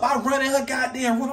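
normal talking voice

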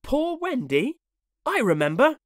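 put-on high, eager child voice; boy's voice. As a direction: remember it eagerly. Slightly recalls.

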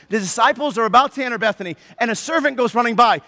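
normal talking voice

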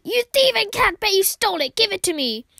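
a loud, mock-angry accusation at the cat; hammed-up grumpy owner scolding the cat; a player voicing the grumpy owner at the cat; mock accusing yell.

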